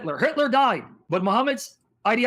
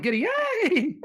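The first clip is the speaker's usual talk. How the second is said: mock giddy cheer; 9 semitones higher, slower than usual. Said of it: "She's like".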